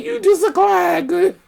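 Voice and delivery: high laughing voice; laughing mock quote. Real, not acted. Mocking the hairdresser's view, laughing. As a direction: squeal it in mock.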